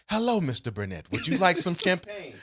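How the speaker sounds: lightly imitated African accent, pitch lifting toward the end. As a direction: attempt a server's African accent, landing somewhere near Australian. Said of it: "African accent that you just used?".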